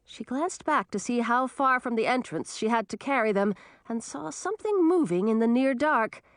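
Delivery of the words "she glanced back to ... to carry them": ordinary delivery, nothing marked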